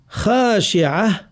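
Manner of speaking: خاشعة slowly, with a full back kha and long a; careful recitation, heavy خ in خاشعة; moderately heavy kha. Shown as a demonstration. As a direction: give خاشعة a dark, emphatic khaa. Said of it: "نفخم صوت الخاء تفخيما".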